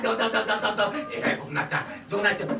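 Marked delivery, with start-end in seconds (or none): none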